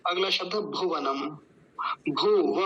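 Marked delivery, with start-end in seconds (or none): 2.1-2.6 भू and व as two separate, full syllables. Shown as a demonstration. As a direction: split भू व, giving व its whole vowel. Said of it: "व, व पूरा है". (भू व में व पूरा बोलें)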